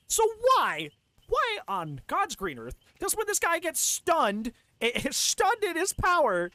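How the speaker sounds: high-pitched, drawn-out exasperation; exasperated rant. Real, not acted. Streamer questioning the killer's stun reaction, exasperated. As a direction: stretch the words out in disbelief, pitch up.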